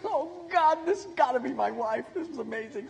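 rising in pitch, awestruck and delighted; overwhelmed by the beautiful girl; smitten excitement. Retold or imitated. Smitten guy thinks to himself.

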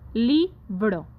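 "Libro" split into syllables, LI strong and BRO falling; stress on LI. Shown as a demonstration. Teacher shows the class the tonic syllable, stressing LI in "Libro". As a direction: lean on the first syllable of "Libro". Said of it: "li es la sílaba tónica".

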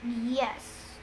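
mildly gruff man's voice. A narrator's reading. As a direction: answer quietly with a touch of gruffness. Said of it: "in a gruff voice".

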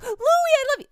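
high, loud put-on voice; changed pitch. Imitating grandmother talking to her dead grandson, high-pitched. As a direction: pitch jumps right up, calling out. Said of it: "her voice pitch and change".

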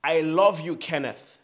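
shouted out, full voice; loud, bold affirmation; loud declaration. Shown as a demonstration. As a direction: declare it boldly to yourself. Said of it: "As loud as you can".

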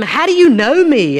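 high, loud astonished question; surprised question. Acted. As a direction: read it out as an astonished man asking. Nathanael's surprised question read aloud.

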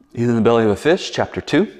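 usual speaking voice

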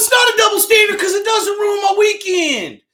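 real argument shouted high and loud; loud, high-pitched outburst of objection; heated shouting. Real, not acted. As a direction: shout back in indignant protest, pitched right up. Heated quarrel over the game.

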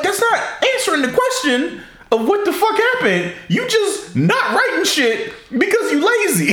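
mock the lazy writers in a put-on voice; scoffing at the lazy bookers, exasperated; mocking exasperation.

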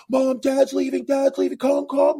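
high-pitched over-the-top calling out; daughter's mock yell. Oldest daughter calling Mom as Dad leaves, high mock yell. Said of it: "the oldest daughter would yell".

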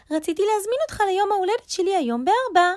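fast, loud, high rabbit voice; eager rabbit. A narrator's reading. Rabbit invites friend to his birthday, fast and high. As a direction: rattle off the invitation, high and quick.

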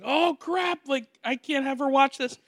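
high, hushed-panic father quote; mock alarm. Father seeing Wolfman come on, high mock alarm. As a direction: play a dad caught out, high-pitched.

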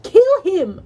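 loud, high fierce command; shout. A narrator's reading. Clarice demands the monster's death, fierce shout. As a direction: yell it with full urgency. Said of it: "Clarice shouted".

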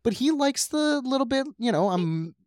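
high, excited chatter; rising into squeaky excitement; excited, high-pitched. Real, not acted. Excited bunny play story.

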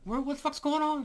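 a hammed-up, baffled pet voice; confused dog voice. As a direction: voice the confused dog in the back window. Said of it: "looking at me like".

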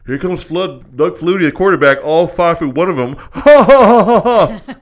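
loud, jeering announcer with big fake laugh; booming mock play-by-play; mocking commentators. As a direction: announce him as a joke, then guffaw. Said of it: "they're mocking my height".

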